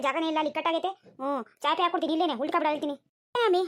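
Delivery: ordinary delivery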